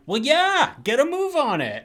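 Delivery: high, loud, impatient mock doctor; bossing the cab driver around; pushy. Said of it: "like, really pushy".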